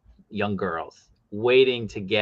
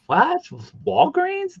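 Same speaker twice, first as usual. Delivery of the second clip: high-pitched incredulous questioning; re-enacted high, rising bafflement; mock disbelief; slower than usual. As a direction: squeak it up as if you can't believe it. Re-enacting disbelief at store exclusives.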